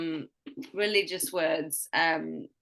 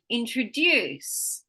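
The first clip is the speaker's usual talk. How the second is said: slow "introduce", in-tro-DUCE; stress on last syllable; much slower than usual. Shown as a demonstration. Teacher giving one more test word, strong DUCE in "introduce". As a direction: stretch the final DUCE of "introduce". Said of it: "tell me where I'm emphasizing".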